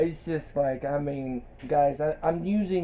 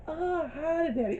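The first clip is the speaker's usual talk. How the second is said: high flirty voice; 13 semitones higher, 6 dB quieter than usual. Retold or imitated. Quoting Angel's flirt.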